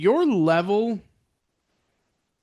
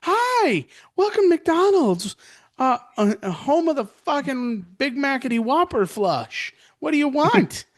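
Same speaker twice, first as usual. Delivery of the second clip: put-on angry teenage girl; 9 semitones higher than usual. Surly teen greets drive-thru customer, high bratty snap. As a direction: sneer it like a fed-up teenage girl. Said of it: "angry little teenage girl".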